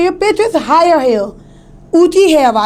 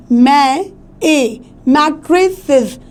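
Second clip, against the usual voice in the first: slow मै, its ए, then mattresses stressed at the start; stress on first syllable; much slower than usual. Shown as a demonstration. Teacher contrasting मै with tray, first-syllable stress in mattresses. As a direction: draw out मै, then say mattresses slowly. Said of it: "मै वो तेज़ आ रहा है".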